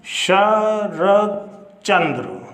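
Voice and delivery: Sharad Chandra drawn out very slowly, syllable by syllable; slow and deliberate teacher dictation. Shown as a demonstration. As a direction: read Sharad Chandra out slowly as if writing it on the board. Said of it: "ये मैंने लिखा है".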